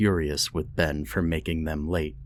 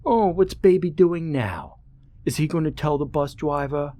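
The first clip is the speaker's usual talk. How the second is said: put-on high, sing-song taunting baby voice; mocking baby talk; 7 semitones higher than usual. Bully on the bus mocks crying Ben, high baby-talk taunt. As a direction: coo at him like he's a toddler, to sting.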